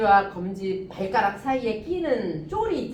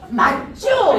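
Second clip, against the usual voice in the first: mock Chungcheong check; 3 semitones higher, 10 dB louder, much slower than usual. Voices Chungcheong person checking.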